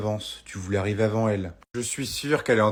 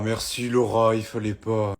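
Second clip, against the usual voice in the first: ordinary delivery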